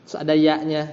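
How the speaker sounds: ordinary talk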